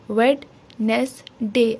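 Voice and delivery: Wednesday as "wed - nes - day", slowly, a gap after each; three split syllables. Shown as a demonstration. Teacher shows the three parts, Wednesday said syllable by syllable. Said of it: "तीन parts हैं या तीन syllables".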